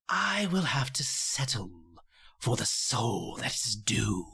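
slow, drawn-out villain menace; deep contempt. A narrator's reading. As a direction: draw every word out with cold disdain. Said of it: "whispers with a voice of deepest contempt".

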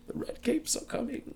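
high, breathy creepy whisper. Retold or imitated. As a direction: taunt it like a sly creep. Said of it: "kinda, like, creepy voice".

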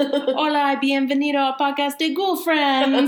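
high, laughing Spanish greeting; playful high Spanish intro. Pretend. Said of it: "doing it in Spanish one time just for funsies".

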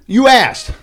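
high, loud playful jab; hammed-up exclamation; mock shouted retort. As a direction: burst out with it to the room. Acts out jeering at losing kids.

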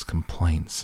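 ordinary delivery, nothing marked

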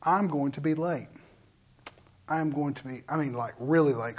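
low, soft muttered realization; muted worry. Retold or imitated. Preacher realizing the class will start without them, low and quiet. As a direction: drop the voice, as if to oneself. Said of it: "And I, and I'm like".

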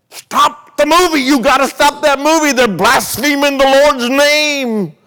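acted-out frantic high-pitched yell, words drawn out; outraged shouting. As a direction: holler at full force to get the film stopped. Acts out man protesting at cinema.

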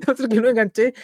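laughing through the words. Real, not acted. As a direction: chuckle while repeating it. Repeats phrase, then laughs.

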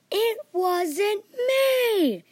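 high, loud, drawn-out screaming cry; yell. A narrator's reading. Boy accused by all the kids, screams his innocence. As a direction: scream it with everything. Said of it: "I yelled".

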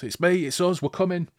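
high-pitched urgent call; acted yell. Hawkmoon calling to the defenders as flames fly, yelling. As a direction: call out to be recognised at the gate. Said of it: "draws his sword and yells".

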